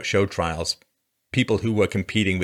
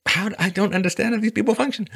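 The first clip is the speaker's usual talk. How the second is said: exasperated squeak; 12 semitones higher than usual. Pretend. Exasperated mock.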